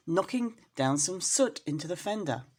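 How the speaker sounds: ordinary delivery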